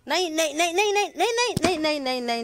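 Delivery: high repeated nos; scolding the puppy. Real, not acted. Owner scolding the puppy climbing up, high scolding nos. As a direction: tell her off as she climbs.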